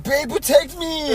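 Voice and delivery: mock whiny boyfriend. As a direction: whimper like a needy boyfriend, laughing. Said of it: "He's like".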